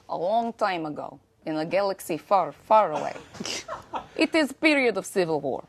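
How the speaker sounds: put-on light Russian accent, laughing through it. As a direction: read it in a playful Russian accent. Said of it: "start it in a Russian accent".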